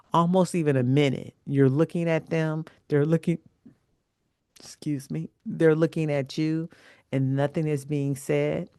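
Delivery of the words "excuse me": quick whispered excuse me; a hushed aside mid-thought. Real, not acted. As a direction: drop to a whisper to excuse yourself.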